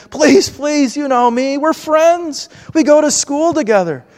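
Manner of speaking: mock-whiny plea to be let into the fort; childish pleading. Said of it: "and I pleaded".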